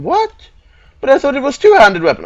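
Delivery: very high, loud, fast protest; outraged disbelief. Real, not acted. Player learns weapon is one-handed, outraged disbelief. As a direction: squawk it in shocked protest.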